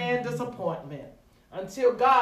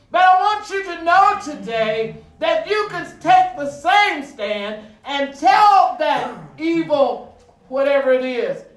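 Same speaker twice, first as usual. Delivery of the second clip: loud, higher, urgent exhortation; raised, driving sermon voice; forceful preaching; 6 semitones higher, 10 dB louder than usual. Real, not acted.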